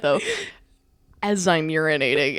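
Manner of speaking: ordinary talk, nothing marked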